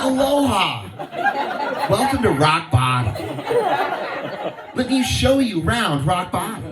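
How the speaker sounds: lightly voiced cheery tour guide; mock host greeting. Comic greets women arriving at rock bottom, mock host voice. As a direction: welcome them like a hammy resort host. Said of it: "I'd be like".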